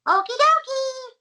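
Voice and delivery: very high, loud squeak; funny high voice. Shown as a demonstration. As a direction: jump way up into a silly squeak. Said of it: "make a funny voice".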